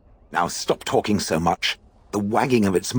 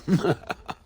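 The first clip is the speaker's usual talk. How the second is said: dry laugh; 10 semitones higher than usual. Real, not acted. Mocking the joke names.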